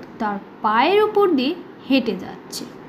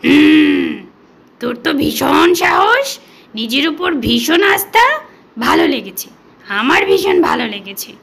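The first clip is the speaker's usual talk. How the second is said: gruff but pleased lion letting the mouse go; amused lion sparing the mouse; growling lion. Acted. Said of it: "হুংকার ছাড়তে ছাড়তে বলল".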